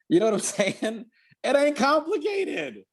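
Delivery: laughing emphasis. Real, not acted. Laughing emphatic point to listener.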